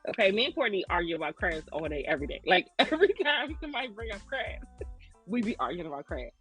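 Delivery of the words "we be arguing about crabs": ordinary talk, nothing marked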